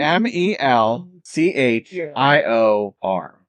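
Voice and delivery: each letter spelled out slowly, M E L C H I O R; the GM spells Melchior letter by letter for the players, slowly. Shown as a demonstration. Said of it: "spelling the name out".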